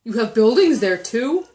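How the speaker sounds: loud, amazed question; astonished gasp. A narrator's reading. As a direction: ask it in open surprise. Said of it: "Horton gasped".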